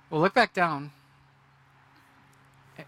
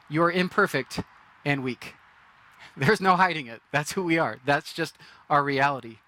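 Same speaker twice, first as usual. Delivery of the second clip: normal talking voice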